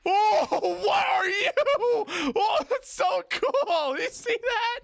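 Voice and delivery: high, loud wondering cry; high excited awe; awed excitement. Real, not acted. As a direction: marvel out loud. Amazed at creature in game.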